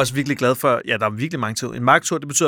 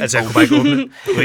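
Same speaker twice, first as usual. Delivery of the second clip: excited; 11 semitones higher, 6 dB louder, much faster than usual. Real, not acted. Correcting own exaggeration.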